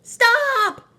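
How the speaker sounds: loud, high sudden shout; yelled stop. A narrator's reading. Marvin stopping his sister from stepping on Rosie, loud shout. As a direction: cry out to halt her step. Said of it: "yelled Marvin".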